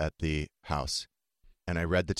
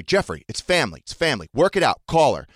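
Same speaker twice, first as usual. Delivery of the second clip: loud, higher, emphatic imitation of dad; retold insistent urging; 6 semitones higher, 9 dB louder than usual. Imitating dad pushing to reconcile, loud insistent urging. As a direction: press each short phrase harder, building louder.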